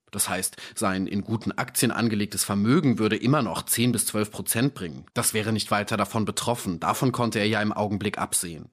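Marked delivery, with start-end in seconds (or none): none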